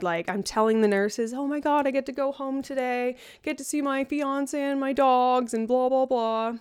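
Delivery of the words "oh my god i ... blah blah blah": higher-pitched retelling of giddy excitement; excited. Retold or imitated. Telling the nurses she gets to go home, excited. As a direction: gush it in a raised voice, trailing into blahs. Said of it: "I'm so excited".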